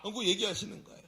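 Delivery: usual speaking voice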